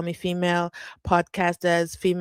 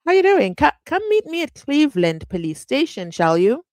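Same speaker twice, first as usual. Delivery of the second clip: higher, louder, chummy mock call to a buddy; hammed-up friendly greeting; 7 semitones higher, 6 dB louder than usual. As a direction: play the brigadier calling his pal over, overly friendly. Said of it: "Geninda said".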